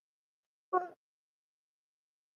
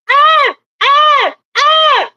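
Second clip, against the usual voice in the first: loud high shrieks; man screaming at the squeaky voice; 10 dB louder, much slower than usual. Acted. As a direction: scream in frustration. Outburst in the bit.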